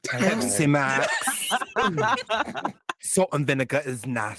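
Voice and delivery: imitating a New Zealand drag queen; playful take on a friend's voice; Lady Lay impression. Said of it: "our best Lady Lay impression".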